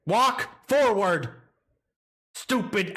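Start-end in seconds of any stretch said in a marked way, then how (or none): 0.1-1.3 frustrated command. Real, not acted. Ordering astronaut.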